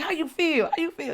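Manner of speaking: high and loud, repeated eagerly; eager question. Retold or imitated. She presses her friend about the jump, high and eager. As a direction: ask twice high and bursting with eagerness. Excited reunion.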